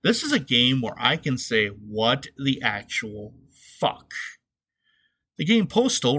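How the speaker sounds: normal talking voice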